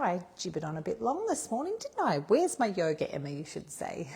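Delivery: normal talking voice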